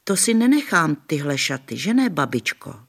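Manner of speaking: put-on high little-girl voice; small girl Adelka. A narrator's reading. Little Adelka asking grandma about her dress, childlike. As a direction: ask like a tiny child. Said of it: "povídala babičce".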